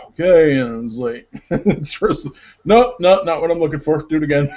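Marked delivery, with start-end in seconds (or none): 0.1-0.6 big sigh, then a louder okay; exasperated compliance. Retold or imitated. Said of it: "the twentieth take, he's just like". 2.6-4.5 mock director's dismissal, loud at first; brisk rejection. Director rejects another take, retold.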